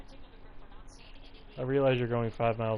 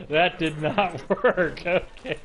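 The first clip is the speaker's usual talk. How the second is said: slow words through laughter; real laughter breaking through the words; laughing; 6 semitones higher, 8 dB louder, slower than usual. Real, not acted. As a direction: still laughing as you speak. Laughing after the glitch.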